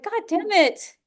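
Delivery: high, exasperated curse; frustrated inner child. Retold or imitated. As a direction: snap it like a fed-up kid. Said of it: "the inner child comes back up and is like".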